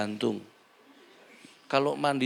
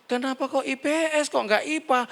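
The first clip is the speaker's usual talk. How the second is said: mock surprised mother; 12 semitones higher than usual. Mother questions child's school choice.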